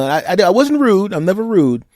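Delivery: usual speaking voice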